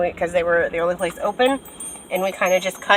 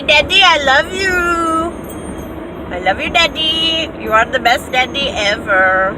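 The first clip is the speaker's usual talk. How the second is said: gushing affection; 6 semitones higher than usual. Real, not acted. Gushing about her dad.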